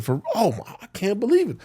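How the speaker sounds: high, rising astonished teacher; mock shocked disbelief. Teacher hearing he's innocent, high shocked disbelief. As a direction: gasp it out in amazement.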